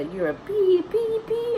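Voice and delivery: high, held beep over swear words; imitated censor bleep. Censoring the bad words hurled at mom, imitated bleep. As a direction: bleep out the insult with a high-pitched "pee". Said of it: "just bad words here".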